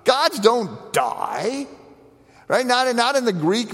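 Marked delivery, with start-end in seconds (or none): 0.0-1.7 high, incredulous scoff, then a slow, drawn-out "Gods don't die"; mock the unbelievers' disbelief; scoffing pagans. Preacher voicing Greeks and Romans mocking a dying God, incredulous. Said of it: "They go".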